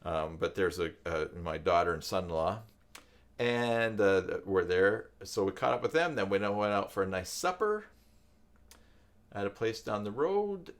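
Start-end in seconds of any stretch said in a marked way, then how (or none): none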